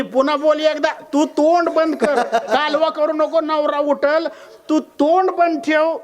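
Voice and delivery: high-pitched, measured acted rebuke; insistent telling-off, each phrase high; indignant scolding. Wife silences neighbour angrily.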